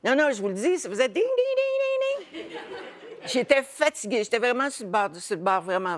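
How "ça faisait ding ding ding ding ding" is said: ringing bell imitation.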